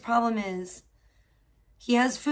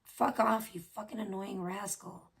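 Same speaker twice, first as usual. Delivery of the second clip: mock muttered insult; 2 semitones lower, 6 dB quieter than usual. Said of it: "I'm like".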